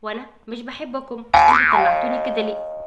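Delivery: put-on a sulky creature scolding the girls; cold cartoon character. Shafa scolding the girls for wishing her out, cold and low.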